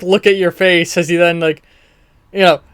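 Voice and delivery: ordinary talk, nothing marked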